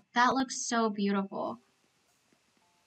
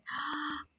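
soft delighted gasp; 2 semitones higher, 11 dB quieter than usual. Real, not acted. Streamer finally sees the fancy diner, delighted gasp. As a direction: draw a quick soft breath.

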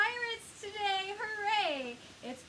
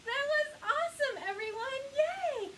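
high, loud happy praise; excited cheer; 9 semitones higher, 4 dB louder, slower than usual. Real, not acted. Cheering after song.

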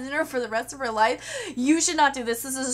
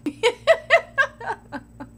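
high helpless laugh; 9 semitones higher, 3 dB louder than usual. Real, not acted. Laughs at question.

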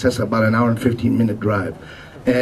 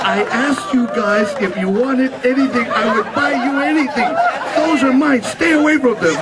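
possessive mock scold; 12 semitones higher than usual. Friend guarding his apple turnovers.

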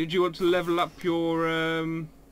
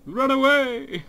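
shouting; 9 semitones higher, 5 dB louder, slower than usual. Real, not acted. Fleeing in game, calling out.

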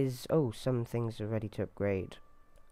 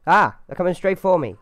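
panicked yelling; 7 semitones higher, 14 dB louder than usual. Real, not acted. Bandits charging at his character.

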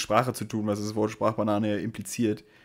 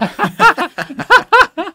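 loud burst of laughter; 16 dB louder than usual. Real, not acted. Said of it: "Der Curveball von Hugo Egon".

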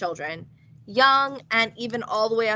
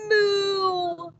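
cow moo; 9 semitones higher than usual. A narrator's reading. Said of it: "And George went".

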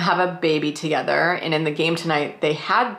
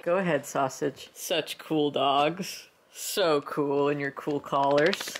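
softly cooing to the dogs; soft talking to dogs; 3 semitones lower, 7 dB quieter, slower than usual. Real, not acted. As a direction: coax the dogs gently.

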